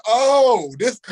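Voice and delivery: sudden high, loud "Oh"; jolted, caught off guard; startled gasp. Retold or imitated. Startled at naked friend with knife.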